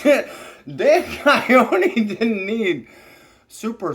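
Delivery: loud, high, slow, laughing. Retold or imitated. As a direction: squeal it through laughter. Listeners laughing at the tale.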